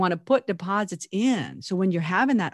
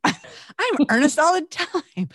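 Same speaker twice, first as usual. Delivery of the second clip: high, laughing self-mockery; 10 semitones higher, 4 dB louder, much slower than usual. Real, not acted. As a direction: laugh through the confession. Laughing at herself.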